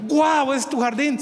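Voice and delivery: high, astonished exclaim; stunned neighbor marveling at the garden; amazed wow. Acted. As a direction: gasp at the sight of it.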